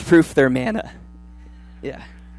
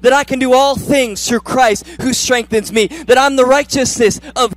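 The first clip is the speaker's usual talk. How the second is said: higher, loud, driving proclamation; full-force preaching; 4 semitones higher, 7 dB louder than usual. Acted.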